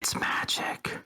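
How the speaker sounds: secretive unvoiced whisper; conspiratorial whisper into someone's ear. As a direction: lean in close and whisper it. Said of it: "lean in real close into his ear and whisper".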